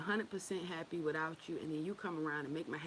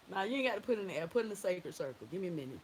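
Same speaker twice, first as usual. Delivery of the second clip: normal talking voice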